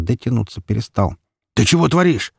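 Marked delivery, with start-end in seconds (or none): 1.5-2.3 high, indignant outburst; alarmed protest. A narrator's reading. Mikola facing his son's gun, indignant protest. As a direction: protest sharply, voice raised.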